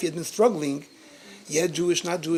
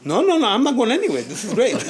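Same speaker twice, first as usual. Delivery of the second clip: excited; 8 semitones higher, 4 dB louder than usual. Real, not acted. Insisting on staying, laughing.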